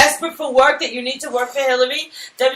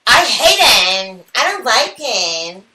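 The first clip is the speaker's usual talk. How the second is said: put-on kid's reply; 3 dB louder, slower than usual. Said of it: "And she goes".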